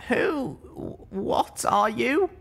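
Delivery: high, wary question; a hurt young mare, uneasy; young mare's voice. Acted.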